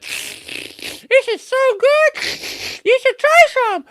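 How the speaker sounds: very high loud drawn-out sweetness; hammed-up lure. A narrator's reading. Anansi calling after Monkey to try the honey, high and loud. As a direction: tempt him back.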